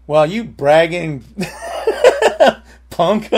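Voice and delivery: laughing through a playful insult; laughing tease. Retold or imitated. Teasing his brother about buying cash, laughing. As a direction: tease him while laughing at the words.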